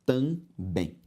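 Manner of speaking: "também" split as tam, bém; syllable by syllable. Shown as a demonstration. Teacher separating the word for students, syllable by syllable. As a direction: pause inside "também". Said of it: "se eu separo".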